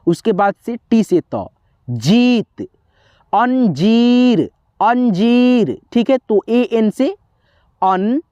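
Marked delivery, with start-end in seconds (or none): 1.9-2.7 जीत with a drawn-out ee; जीत said as jeeet; stretched long ee. Shown as a demonstration. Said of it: "खींच करके उच्चारण". 3.3-5.8 अंजीर with जी drawn out slowly; अंजीर said slowly as an-jeeer; stretched long ee. Shown as a demonstration. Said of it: "खींच करके उच्चारण".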